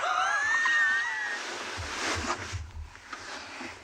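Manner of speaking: long, high-pitched laughing fit; real, breathless laugh; helpless laughter. Real, not acted. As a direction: crack up completely at your own attempt. Cracks up at own accent attempt.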